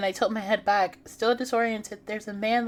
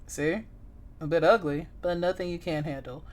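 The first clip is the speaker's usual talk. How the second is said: low calm man; 4 semitones lower, 4 dB quieter than usual. Acted. Male character voice.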